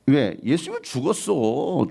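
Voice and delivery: ordinary delivery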